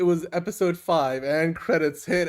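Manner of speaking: usual speaking voice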